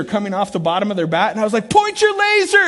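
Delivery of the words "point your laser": high, loud shouts from behind the plate; shouted coaching cries; coach yelling. Retold or imitated. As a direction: bellow it like a Little League coach. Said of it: "I'm yelling all this stuff".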